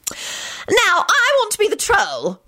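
very high, drawn-out bossy whine; high-pitched, insistent demand. A narrator's reading. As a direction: announce it as a bossy mum claiming her due. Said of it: "Molly announced bossily".

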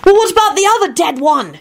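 very high, urgent question; hissed protest. A narrator's reading. Timothy raising the other dead man, hissed. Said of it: "hissed Timothy".

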